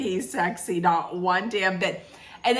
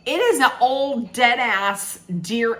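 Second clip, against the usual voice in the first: ordinary talk, nothing marked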